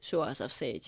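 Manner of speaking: ordinary delivery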